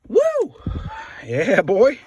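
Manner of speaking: loud happy whoop; excited cheer. Real, not acted. Celebrating part popping loose.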